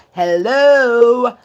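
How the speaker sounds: loud, drawn-out mock hello; calling out. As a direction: holler it like someone locked outside. Acts person calling at door.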